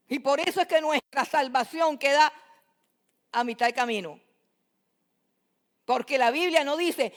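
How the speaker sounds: loud, driving pulpit voice; full-force preaching. Acted. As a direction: pound the truth home to the congregation. Preaching climax.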